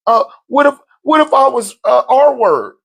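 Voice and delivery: high, pleading mock excuse; mocking whiny excuse. Mocking women's excuse to viewers.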